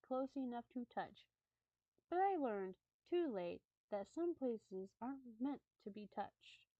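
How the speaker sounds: measured, slightly hushed storytelling; story narration. Acted. Streamer reads creepy camping story to viewers, storytelling voice. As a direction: read it like a campfire tale.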